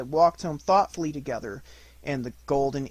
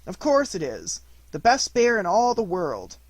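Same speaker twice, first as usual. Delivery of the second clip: proud praise; 6 semitones higher, 7 dB louder than usual. A narrator's reading. Christopher Robin praises Pooh.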